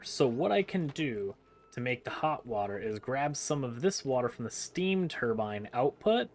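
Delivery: normal talking voice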